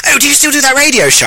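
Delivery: put-on high-pitched posh English accent; posh man. Posh acquaintance asking about the radio show, high posh voice. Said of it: "he is actually posh".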